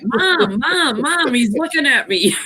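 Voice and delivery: put-on high whiny kid calling Mom; whiny tattling little sibling. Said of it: "And they're like".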